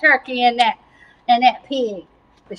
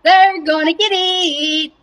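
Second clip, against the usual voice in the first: high-pitched cackling taunt; mocking laugh; 6 semitones higher, slower than usual. Cow laughing at the doomed animals, gloating mock laughter. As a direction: gloat through a put-on belly laugh. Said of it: "laughing at 'em".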